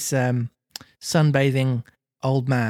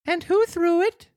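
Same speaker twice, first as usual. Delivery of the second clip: very high little marionette; puppet character voice. Acted. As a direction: ask it in a squeaky, pitched-up voice. Pinocchio's voice questions old man.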